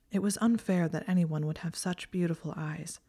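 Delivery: ordinary delivery, nothing marked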